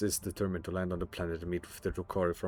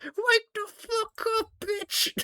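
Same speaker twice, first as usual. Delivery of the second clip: much higher, slow squealing mock battle yell; much slower than usual. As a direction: scream it like a raider on the battlefield. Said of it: "all you're just doing is like".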